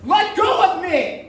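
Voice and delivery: high, loud, struggling cry; desperate cry. Acted. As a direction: fight to break free. Jim struggles against his captor.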